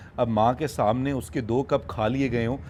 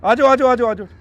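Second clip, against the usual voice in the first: louder, higher voice coaxing the cub out; louder high coaxing call to the cub; 10 semitones higher, 8 dB louder than usual. Real, not acted. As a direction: call him over warmly. Calling cub.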